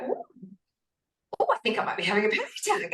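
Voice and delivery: quick, curious "oh" of discovery; retold dawning excitement, rattled off; intrigued, as if making a discovery; excited realisation. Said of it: "I got really excited".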